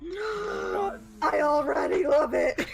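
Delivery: slow, delighted squeal; thrilled, drawn-out gush. Real, not acted. Delighted reaction to sheep planet.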